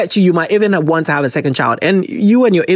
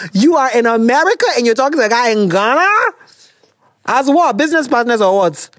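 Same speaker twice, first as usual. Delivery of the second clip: higher-pitched teasing disbelief; incredulous; 5 semitones higher than usual. Real, not acted. Host challenging the letter writer in America, incredulous. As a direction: question it in high, rising disbelief.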